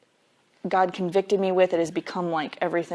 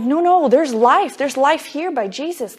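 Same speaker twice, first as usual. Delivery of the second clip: urgent plea; 6 semitones higher, 4 dB louder than usual. Retold or imitated. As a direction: call out to the flock, mildly urgent. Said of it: "I'm like".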